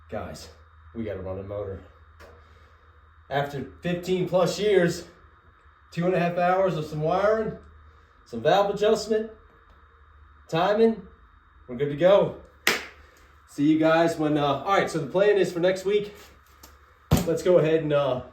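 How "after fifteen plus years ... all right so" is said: loud and high, a triumphant wrap-up; proud excited announcement. Real, not acted. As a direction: announce it like a victory.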